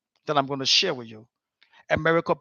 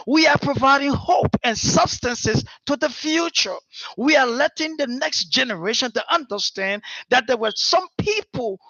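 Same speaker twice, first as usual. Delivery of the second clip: high, loud, ringing oration; preaching at full force; 6 semitones higher, 4 dB louder than usual. Acted. Speaker rousing the audience about future diplomats, full preaching. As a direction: proclaim it to the whole hall.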